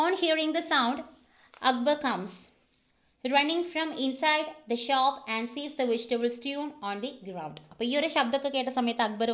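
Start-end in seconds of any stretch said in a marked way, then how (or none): 0.1-7.6 reads each word out slowly, like a dictation; slow script reading. Shown as a demonstration. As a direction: spell out the scene calmly for the class. Reading screenplay aloud slowly.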